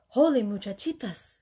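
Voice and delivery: usual speaking voice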